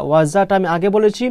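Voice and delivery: ordinary talk, nothing marked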